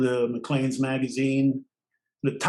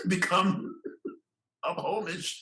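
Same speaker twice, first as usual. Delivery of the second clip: slow, higher, broken by chuckles; amused, laughing; 4 semitones higher, 5 dB quieter than usual. Real, not acted. Speaker admitting they picked up the household's habits, laughing. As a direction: laugh through the words. Laughing at own coined word.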